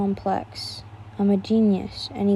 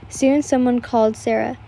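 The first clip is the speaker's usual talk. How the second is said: ordinary delivery, nothing marked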